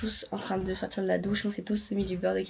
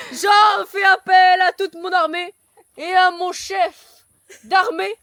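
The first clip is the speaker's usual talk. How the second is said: loud, slow grand proclamation; pompous emperor; 10 semitones higher, 9 dB louder, slower than usual. Acted. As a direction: declaim like a Roman emperor. Acting Roman emperor speech.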